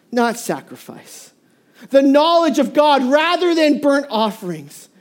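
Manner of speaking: scripture reading. Acted. Preacher voicing God to Israel, lamenting.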